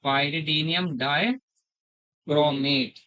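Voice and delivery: "pyridium dichromate" stretched out, every syllable drawn long; slow dictation. Shown as a demonstration. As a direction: dictate "pyridium dichromate" slowly so students can write. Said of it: "teacher dictates reagent name slowly". ("pyridium dichromate" बहुत धीरे-धीरे खींचकर)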